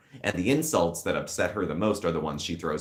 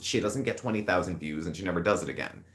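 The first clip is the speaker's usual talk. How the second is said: ordinary talk, nothing marked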